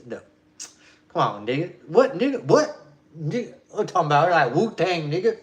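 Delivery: put-on outraged voice, punching out the words; imitating indignant objector. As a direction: mimic someone loudly objecting. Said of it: "they did it like this".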